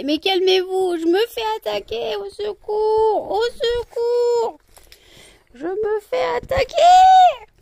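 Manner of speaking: high, loud, stretched-out mock distress; hammed-up au secours; mock cry for help. Owner to her dogs, hammed-up high cries for help.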